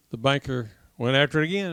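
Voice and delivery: ordinary talk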